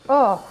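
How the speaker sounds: pained gasp. Real, not acted. Hot sauce pain.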